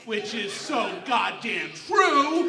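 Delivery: loud, slow, drawn-out declaration; emphatic shout. Real, not acted. Comic proudly shouting to the crowd that he's hot, slow big shout. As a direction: belt it out proudly.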